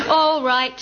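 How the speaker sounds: put-on a loud drunk's holler. A narrator's reading. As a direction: throw it out as a challenge. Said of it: "he hollered".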